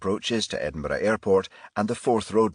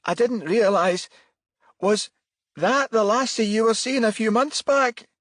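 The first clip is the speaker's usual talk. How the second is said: anxious mother; 6 dB louder than usual. Acted. Anxious mother questions son.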